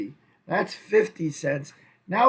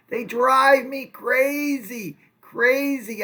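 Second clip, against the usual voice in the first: exasperated; 6 semitones higher, 5 dB louder, much slower than usual. Real, not acted. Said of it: "I hate doing these exercises".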